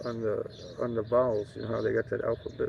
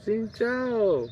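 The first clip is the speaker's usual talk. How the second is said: much higher put-on voice, slow and drawn out; mimicking a local guy; 9 semitones higher, much slower than usual. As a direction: play a local man greeting in a high, stretched-out voice. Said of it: "He goes".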